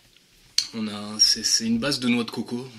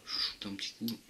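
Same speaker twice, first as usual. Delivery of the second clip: ordinary talk, nothing marked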